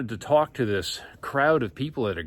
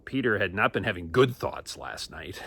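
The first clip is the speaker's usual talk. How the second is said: ordinary delivery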